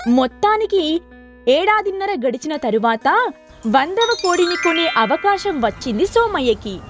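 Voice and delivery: ordinary delivery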